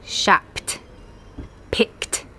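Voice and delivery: "Shopped", "Picked" ending in a crisp t. Shown as a demonstration. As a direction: release a voiceless t after "Shopped" and "Picked". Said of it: "like a T".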